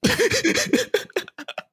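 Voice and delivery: high-pitched wheezy laughter. Real, not acted. Laughing at the story.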